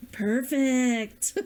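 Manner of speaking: Perfect said with a laugh and a falling end. Pretend. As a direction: let Perfect drop with a chuckle.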